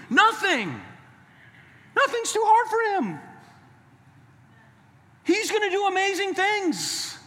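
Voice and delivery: high, impassioned preacher's cry; full-force preaching. Real, not acted. Preacher assuring the congregation in difficulty, impassioned cry. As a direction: proclaim it far above your talking pitch.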